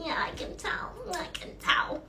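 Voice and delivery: breathless unvoiced yeah I can tell; whispered queasy reply. Answering the alpha in a faint queasy whisper. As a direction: whisper it, trying not to throw up.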